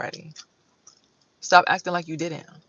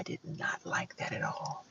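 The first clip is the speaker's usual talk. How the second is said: whisper; 12 dB quieter, slower than usual. Real, not acted. Aside about scary ride.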